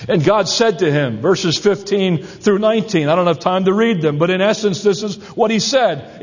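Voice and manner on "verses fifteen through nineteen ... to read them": normal talking voice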